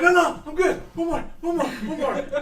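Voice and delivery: high, loud giddy insistence; dizzy gnome begging to spin again. Acted. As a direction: beg for another go, laughing. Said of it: "she's like".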